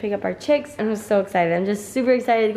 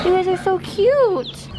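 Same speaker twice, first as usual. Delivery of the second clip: high, slow cooing at chicks; 8 semitones higher, slower than usual. Real, not acted. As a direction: gush over the little chicks.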